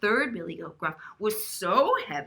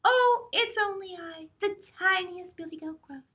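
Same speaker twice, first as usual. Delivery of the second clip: tiny goat voice, much higher; very small voice; 10 semitones higher than usual. Acted. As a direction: squeak it out like the littlest goat. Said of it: "in his very small voice".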